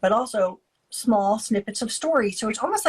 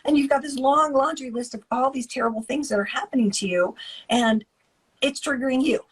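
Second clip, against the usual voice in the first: usual speaking voice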